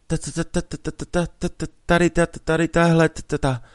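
put-on a frightened boy stuck on every syllable; scared stutter. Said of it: "čím dál tím víc ustrašený".

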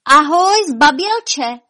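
high, loud taunting call. A narrator's reading. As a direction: call across at the coward. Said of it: "zavolala na něj".

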